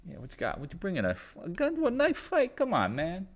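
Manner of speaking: a hint of an Andrew impression. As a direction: tease like a smug action hero. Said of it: "I can do his voice too".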